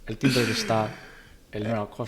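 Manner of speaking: a hint of a creaky abuelo; old man voice. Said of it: "I can do it so much better".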